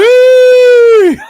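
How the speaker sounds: very high, loud burst of laughter; shrieking laugh. Real, not acted. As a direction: crack up in a shriek. Laughs at United's collapse.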